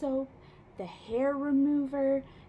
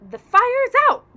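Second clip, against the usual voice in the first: higher, louder triumphant call; proud announcement; 10 semitones higher, 14 dB louder than usual. A narrator's reading. Boy proudly tells grandma the fire is out, loud. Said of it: "announced proudly".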